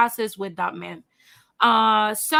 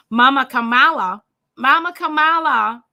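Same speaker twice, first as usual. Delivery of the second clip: playful loud call; 2 semitones higher, 8 dB louder than usual. Acted. Vlogger to her viewers, loudly hyping a pet nickname. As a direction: announce it like a hype name.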